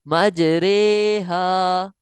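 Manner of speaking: मजरेहा with echoing ज and रे, slow; qalqala and imala. Shown as a demonstration. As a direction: bounce the ज in मजरेहा then say रे. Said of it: "यहाँ पर कलकला भी करना है". (मजरेहा कलकला और इमाला के साथ)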